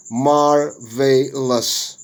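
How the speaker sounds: "marvelous" slowly split as mar, vay, lus; syllable by syllable. Shown as a demonstration. Teacher showing syllable division, "marvelous" broken into syllables. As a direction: say "marvelous" slowly in three separate beats. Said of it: "Syllable division".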